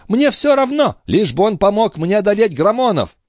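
high, impassioned declaration; defiant, agitated outburst; vehement exclamation. A narrator's reading. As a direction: press on heatedly in a high voice. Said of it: "воскликнула госпожа Дюбари".

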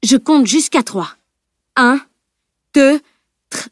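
deliberate warning, cut off at the end; menacing, hard tone. A narrator's reading. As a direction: count as a stern ultimatum. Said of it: "menaça-t-elle d'un ton dur".

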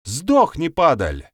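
loud curse, starting high then dropping; venomous curse. A narrator's reading. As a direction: hurl the curse at an enemy. Curse hurled at the man.

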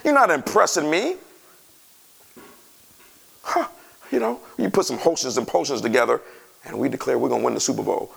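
normal talking voice

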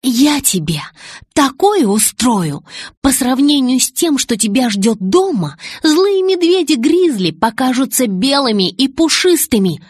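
forceful angry warning, heavily stressed; emphatic threat. A narrator's reading. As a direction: hit each word as you threaten. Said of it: "делая ударение на каждом слове".

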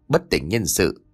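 ordinary delivery, nothing marked

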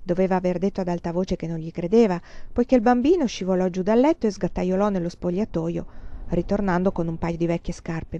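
ordinary delivery

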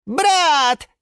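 high, loud single cry; shouted call. A narrator's reading. Sushil calls to Yura from the aquarium, shouting. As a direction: call out to a long-lost brother. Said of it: "закричал в ответ голос".

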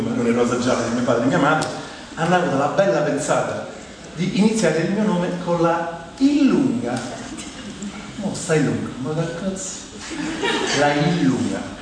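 normal talking voice

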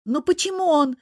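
high-pitched whine; complaining. A narrator's reading. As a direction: wail your grievance to mum. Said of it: "начала жаловаться Тереза".

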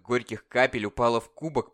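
plain reading voice

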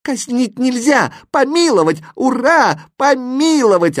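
high, loud jubilant cheering; exultant shouts at top pitch; overjoyed. A narrator's reading. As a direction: exclaim the verdict in delight. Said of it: "обрадовался восклицательный".

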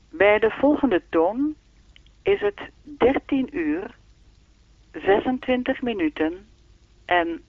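even, measured time announcement; speaking clock voice. Acted. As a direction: read the time like the recorded clock. (zoals de sprekende klok)

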